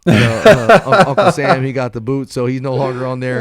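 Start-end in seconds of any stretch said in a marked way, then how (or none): 0.1-1.6 drawn-out loud filler, then the punchline; hammed-up stalling before the joke; loud comic drawl. Jokey loud buildup to punchline.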